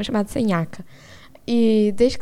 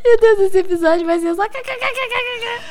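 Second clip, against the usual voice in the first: falando entre risadas, lento; laughing through the words; 11 semitones higher, 3 dB louder, slower than usual. Real, not acted. Laughing hard at the answer. (rindo muito)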